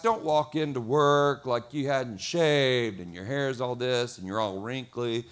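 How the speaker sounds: normal talking voice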